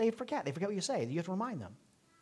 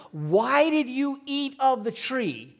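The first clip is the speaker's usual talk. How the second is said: higher, slow, louder question; slow, raised divine question; God questioning Adam; 8 semitones higher, 5 dB louder, slower than usual. Retold or imitated. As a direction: voice God calling Adam to account. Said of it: "he said to him".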